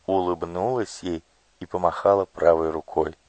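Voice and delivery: ordinary delivery, nothing marked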